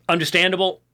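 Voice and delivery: ordinary talk, nothing marked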